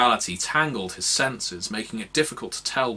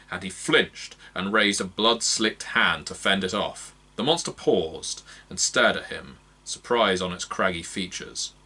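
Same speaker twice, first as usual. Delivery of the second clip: plain reading voice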